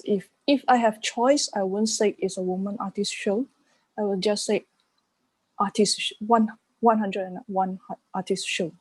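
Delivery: ordinary delivery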